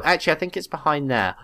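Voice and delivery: normal talking voice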